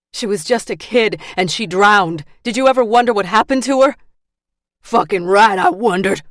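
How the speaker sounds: loud, high heated shouting; angry shouting. Acted. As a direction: shout it at him. Shouting argument over drowned girl.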